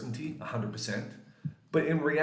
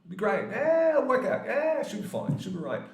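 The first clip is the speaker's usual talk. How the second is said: reassuring; 12 semitones higher, 3 dB louder than usual. Retold or imitated. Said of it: "help people remove it".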